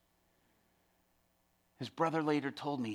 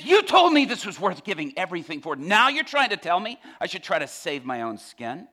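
loud, high, indignant retort; impassioned challenge; 6 semitones higher, 11 dB louder than usual. Retold or imitated. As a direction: confront your teacher with their own teaching. Inmate challenges the preacher's warning.

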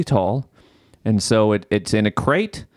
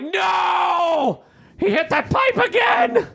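very high, shouted comic panic; laughing high-pitched shout of alarm; mock yell; slower than usual. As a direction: scream it in falsetto disbelief. Retold alarm at pipe crash.

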